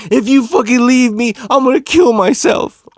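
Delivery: high, loud mock-desperate wail; melodramatic threat. Cousin threatens girlfriend over speakerphone, hammed-up desperation. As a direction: oversell the threat, imitating a frantic boyfriend. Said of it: "he was trying to sell this shit".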